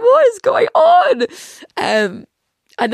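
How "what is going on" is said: high, slow, loud disbelief; baffled shriek. Retold or imitated. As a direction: shriek in total confusion. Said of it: "I was like".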